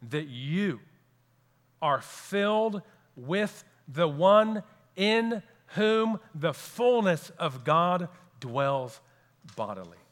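said again slowly, each word weighed; slow emphasis. Shown as a demonstration. Preacher repeating to the congregation, slow deliberate emphasis. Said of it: "I'll say it again for emphasis".

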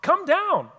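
high, loud jeering dare; mocking taunt. Crowd jeering at Jesus on the cross, loud mocking dare. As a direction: taunt him like the scoffing crowd.

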